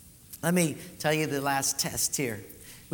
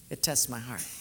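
softer, lower repeat of "It tests my heart", leaning on "tests"; quieter repeat; 6 semitones lower, 5 dB quieter than usual. Shown as a demonstration. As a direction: let "It tests my heart" drop away low and soft.

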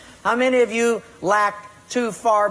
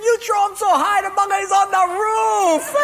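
laughing Portuguese guy; 13 semitones higher than usual. Retold or imitated. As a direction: crow it out high and laughing. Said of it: "the Portuguese guy's laughing".

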